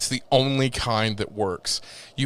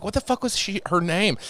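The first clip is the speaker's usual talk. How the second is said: asking his name; 8 semitones higher than usual. Retold or imitated. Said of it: "I even asked him, I was like".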